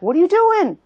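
high, loud mock-indignant yell; confronting question. Others confronting the speaker over stopping, indignant imitation. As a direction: call it out sharply, high and loud, as if catching someone.

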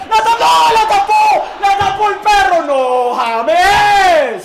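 loud, high shouts as the penalty is saved; real yelling. Real, not acted. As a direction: cry out in shock. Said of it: "estoy gritando mucho".

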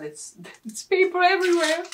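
laughing, high and loud. Real, not acted. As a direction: laugh through the words. Laughs at the paper mess.

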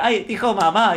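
high-pitched doting parent gush; thrilled parent. Acted. Parent marveling at a toddler's first word, mock-thrilled high voice. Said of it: "cuando habla tu hijo por primera vez".

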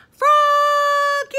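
high, loud, long-held call. A narrator's reading. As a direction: holler the name across the house. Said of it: "called his father".

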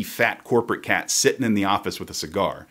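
usual speaking voice

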